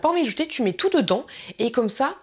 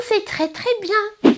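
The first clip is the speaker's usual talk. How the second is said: slow, much higher prim voice; prissy mock; 11 semitones higher, 4 dB louder, much slower than usual. Mocking purists who keep just one, slow high prissy voice. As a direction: draw it out like a smug minimalist.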